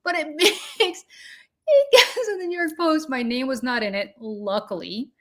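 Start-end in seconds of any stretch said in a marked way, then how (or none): 0.0-3.1 high pitched, laughing through the words; cracking up at her own story. Real, not acted. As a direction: giggle it out in disbelief.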